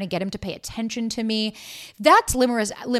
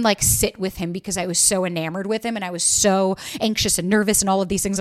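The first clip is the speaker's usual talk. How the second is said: ordinary talk, nothing marked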